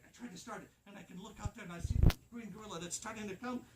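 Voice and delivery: plain reading voice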